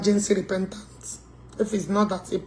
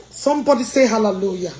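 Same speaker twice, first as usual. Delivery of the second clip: usual speaking voice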